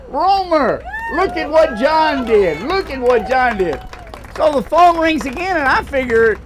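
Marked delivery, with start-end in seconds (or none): 0.1-3.8 high, loud, excited calling out; high, loud female chorus calling "Romer"; excited women. Retold or imitated. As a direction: imitate the thrilled women in the family. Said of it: "all the women in my life are saying".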